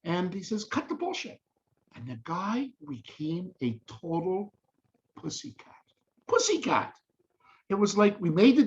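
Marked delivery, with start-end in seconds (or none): 0.6-1.4 much higher, punchy cut the bullshit; high, punched repeat of the order; emphatic retell. Retold or imitated. Retold line, much higher. 5.2-5.8 unvoiced, breathy pussycat; hushed pussycat; whispered. Whispered punchline word. 6.3-6.9 much higher, falling Pussycat; high delighted Pussycat; emphatic. Retold or imitated. Repeated punchline, much higher.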